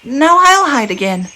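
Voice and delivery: a tiny cub bursting with glee; little bear voice. A narrator's reading. Little Bear's voice in story.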